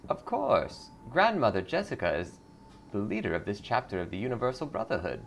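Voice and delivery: sugary cult greeter; lightly voiced sweet cult recruiter. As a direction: welcome her in like a smiling recruiter.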